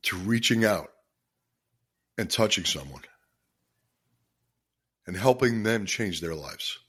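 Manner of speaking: fighting back tears to keep talking to listeners; choked up with emotion. Real, not acted. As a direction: voice catching, long pauses between phrases. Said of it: "I get pretty emotional about this stuff".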